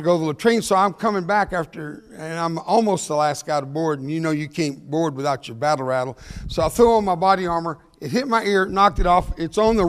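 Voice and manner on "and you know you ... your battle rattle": usual speaking voice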